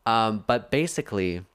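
ordinary talk, nothing marked

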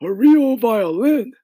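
high, slow, loud exclamation; old grasshopper crying out in disbelief; indignant cry. Acted. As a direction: draw it out high, as if affronted. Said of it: "the old green grasshopper cried".

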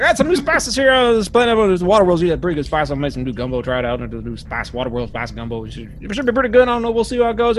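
high, eager rambling cook; chattering Buzz. Acted. Steward jabbers about spice gumbo on the walk. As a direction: talk their ear off about gumbo. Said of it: "He's like".

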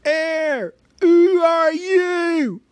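high, slow squealing shout; squeaky purse voice. A narrator's reading. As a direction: squeal it like a startled little purse. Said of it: "it squeaked as it left the pocket".

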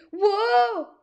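sudden yelp of surprise; startled whoa. Acted. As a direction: cry out as you trip. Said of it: "fallen into a ditch".

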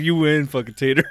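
conceding through laughter; laughing concession. Retold or imitated. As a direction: give up while cracking up. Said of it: "I was like".

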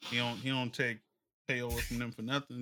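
ordinary talk

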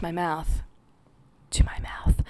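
whispered close to the mic; breathy ASMR murmur. Shown as a demonstration. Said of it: "Please don't A S M R everyone".

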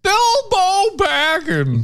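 high-pitched comic actor as a wizard; strained high impression, drawn out theatrically; Don Knotts. Attempting Don Knotts as Gandalf for a skit, high-pitched. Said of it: "Don Knotts as".

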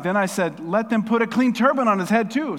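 loud, high scripture outburst; excited blurting. Acted. Zechariah interrupting to ask for a clean turban, blurting. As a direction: burst out with the quote, eagerly. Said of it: "it's like Tourette's in the Bible".

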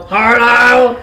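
loud, bright call of hello; mock movie-Edward greeting; Edward's hello. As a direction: greet her like a dashing vampire. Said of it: "I hear Edward go".

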